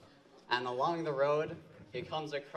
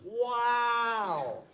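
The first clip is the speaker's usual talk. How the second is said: loud, high wow; excited wow; 9 semitones higher, 7 dB louder than usual. Acted. Frog marvels at the goat, shouting high. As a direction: burst out amazed.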